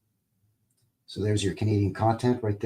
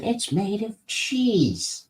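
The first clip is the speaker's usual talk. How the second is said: slow mock quote; 11 semitones higher, much slower than usual. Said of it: "when they said".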